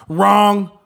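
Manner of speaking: high, loud emphatic wrong; shouted Wrong. Real, not acted. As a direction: slam the word Wrong like a verdict. Punchline rebuttal to own plan.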